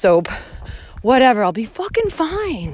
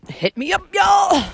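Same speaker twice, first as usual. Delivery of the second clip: calling out; 10 semitones higher, slower than usual. Real, not acted. Inviting listeners to reach out, a high call-out. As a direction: call out to the crowd.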